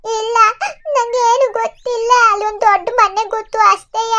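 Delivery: put-on high childlike voice with a whimpering cry; a lost girl half crying as she answers; lost girl, tearful. Girl telling the man she remembers only a big house, high and tearful.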